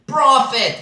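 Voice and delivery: high, loud accusing cry; frantic shout. Acted. Narrator accuses the raven, high frantic shout. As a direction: hurl the word at the bird.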